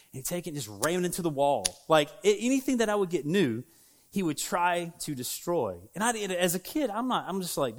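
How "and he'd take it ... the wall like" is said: ordinary talk, nothing marked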